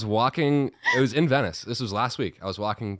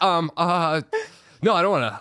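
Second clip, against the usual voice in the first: flustered refusal; 7 semitones higher, 6 dB louder than usual. Retold or imitated.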